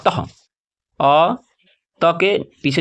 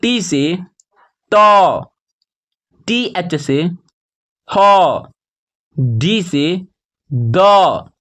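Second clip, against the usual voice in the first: slow chant of spelling, then the letter held long; measured classroom recitation with pauses; spelling drill; much slower than usual. Shown as a demonstration. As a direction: land firmly on each drawn-out consonant.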